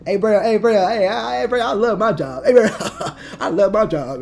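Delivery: loud, high, giddy outburst; excited hollering. Real, not acted. Streamer delighted by his game job, excited hollering. Excited reaction, laughing along.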